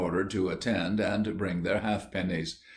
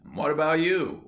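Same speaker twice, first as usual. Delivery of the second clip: higher and louder, what about you; a boy turning the blame around, higher and louder; indignant retort; 8 semitones higher, 4 dB louder than usual. A narrator's reading. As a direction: fling what about you back at him. Ginger retorts.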